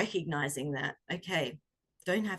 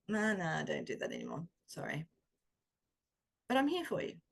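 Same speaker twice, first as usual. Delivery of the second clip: gentle kind friend; 4 dB quieter than usual. Acted. Kind voice answering the inner critic.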